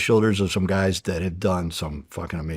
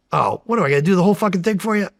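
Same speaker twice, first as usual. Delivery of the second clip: higher, fast, sarcastic put-upon retort; exasperated mockery; 10 semitones higher, 4 dB louder, faster than usual. Explosives guy mocking an agent's request, higher and fast. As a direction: toss it off like it's a dumb question.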